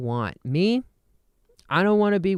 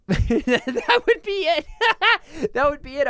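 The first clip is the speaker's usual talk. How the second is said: laughing; 13 semitones higher than usual. Real, not acted. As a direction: crack up while saying it. Laughing at own bit.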